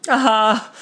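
high, loud triumphant aha; triumphant exclamation. A narrator's reading. Carl figuring out Bert's old suit, triumphant. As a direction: pounce on the discovery. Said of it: "Carl exclaimed".

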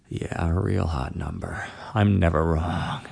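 low, rough whisper; hoarse whisper. Acted. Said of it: "Ed whispered in a hoarse voice".

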